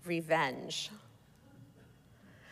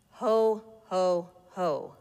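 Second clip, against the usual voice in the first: Santa's laugh losing its steam; unenthusiastic ho-ho-ho; slower than usual. A narrator's reading. Surly mall Santa greeting a child, ho-ho-ho without enthusiasm. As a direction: let the last ho drop, no exclamation. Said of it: "no exclamation point after that last ho".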